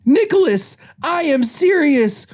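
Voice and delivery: very high, stretched-out demand; exasperated scolding. Acted. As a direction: scold with the full name, high and emphatic. Judy scolding Nick by full name.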